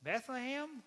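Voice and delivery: high, incredulous wife's echo; Mary. Acted. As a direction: repeat it in high-pitched disbelief. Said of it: "Mary says".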